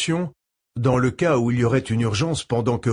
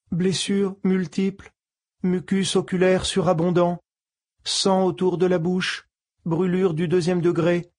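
computer voice; 7 semitones higher than usual. Acted. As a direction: read it like a calm medical computer. Ship computer's medical voice.

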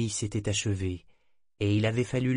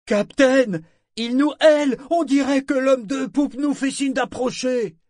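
loud hail; 8 dB louder than usual. Acted. Sorkor hailing his captain loudly.

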